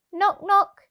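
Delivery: ordinary delivery, nothing marked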